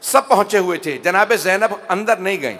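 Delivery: ordinary delivery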